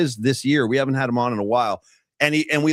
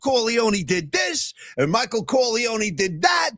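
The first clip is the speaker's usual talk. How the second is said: Frank Pantangeli impression; 8 semitones higher than usual. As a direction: plead it like a lying witness. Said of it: "as Frank Pantangeli said".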